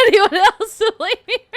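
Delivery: high, loud words through laughter; laughing protest. Real, not acted. Not wanting blame for sad chat, laughing protest. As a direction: protest it while cracking up.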